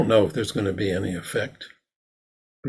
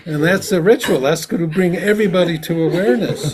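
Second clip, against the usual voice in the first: laughing; 6 semitones higher than usual. Real, not acted.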